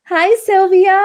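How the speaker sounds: high, loud excited hello; excited greeting. Real, not acted. Host greeting her guest warmly on air, excited and high.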